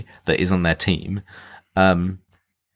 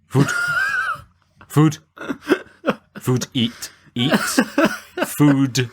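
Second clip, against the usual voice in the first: higher-pitched mock animal fixated on food; simple animal; 8 semitones higher, much slower than usual. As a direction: blurt single words like a dumb animal, with pauses between. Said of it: "an animal just being like".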